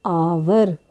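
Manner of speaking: आवर drawn out, long "aa" first, no h; slow teacher's model of आवर with silent h; sounded out. Shown as a demonstration. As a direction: stretch आवर from its opening vowel. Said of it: "क्या पढ़ते हैं".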